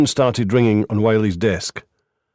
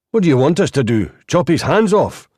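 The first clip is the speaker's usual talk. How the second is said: angry retort; 7 semitones higher, 4 dB louder than usual. Acted. Reynolds snapping at the lawyer.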